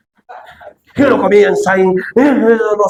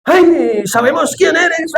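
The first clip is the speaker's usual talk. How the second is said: high, slow whiny wail; mock demon cry; 12 semitones higher, much slower than usual. Preacher mimicking a demon to the congregation, high mock wail. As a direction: imitate a tormented demon crying out. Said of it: "así como el demonio".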